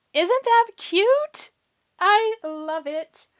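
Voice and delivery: very high, slow gushing voice; cute squeal. Real, not acted. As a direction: squeal over something adorable. Admiring finished flower.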